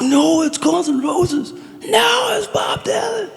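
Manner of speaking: high, drawn-out mock shouting match; mock yell. Retelling a teenage quarrel with a friend, hammed-up high yell. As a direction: squeal both sides of the argument way up high. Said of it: "we were really yelling at each other".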